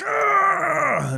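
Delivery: loud, high cry of pain; sudden acted yell from a wound. Wounded man's hands moved on his wounds, pained yell. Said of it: "wiggles the guy's hands on his wounds".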